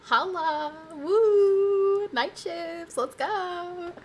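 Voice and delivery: high, loud whoop; cheering. Real, not acted. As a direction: celebrate out loud. Cheering the return to night shifts.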